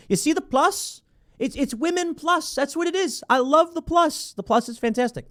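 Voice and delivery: high-pitched gushing over it; sing-songy high delight, tongue in cheek; gleeful mock delight. Real, not acted. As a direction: crow about it in a high teasing voice. Gleefully mocks the plus sign.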